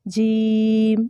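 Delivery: جیم with the vowel held long and slow; drawn-out jeem. Shown as a demonstration. Teacher stretching جیم so students hear the length. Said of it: "جیسے اوپر جیم میں ہے".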